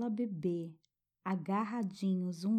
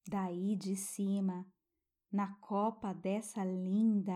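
guided meditation. Acted. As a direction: soothe a child toward sleep.

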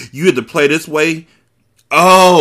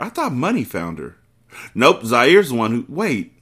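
ordinary talk, nothing marked

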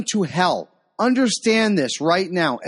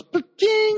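high chirpy imitated bell ding; squeaky mock notification ping, much higher; notification chime. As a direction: sing out the phone alert sound. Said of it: "this little thing that goes".

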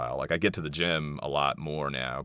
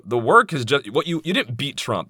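usual speaking voice

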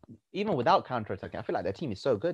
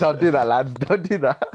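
louder, half-laughing protest; loud pleading; 2 semitones higher, 7 dB louder, slower than usual. Real, not acted. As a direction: beg them loudly, half joking. Begging mates not to copy captaincy.

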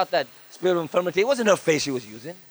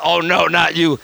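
louder, imitated devil's dismay; demon voice; 3 semitones lower, 5 dB louder than usual. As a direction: imitate a demon recognising the preacher. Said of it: "the devil in her".

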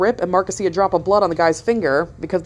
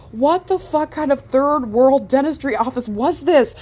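higher, slow, disbelieving rant; incredulous; 8 semitones higher, slower than usual. Retold or imitated. Driving away from the dentist, incredulous to Mark. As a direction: drag it out in total disbelief. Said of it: "I'm like".

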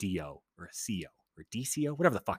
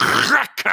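loud, drawn-out cracking noise; imitated bone crack; 10 semitones higher, 10 dB louder than usual. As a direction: mimic a big crunching pop.

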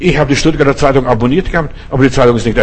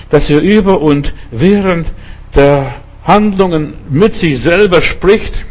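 ordinary delivery

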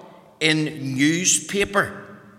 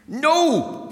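refusing; 9 semitones higher than usual. Acted. Refuses the old lady.

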